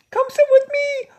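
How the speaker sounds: put-on very high, loud child's voice; kids calling out. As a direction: call from the bus window like a kid. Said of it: "the other kids are looking out".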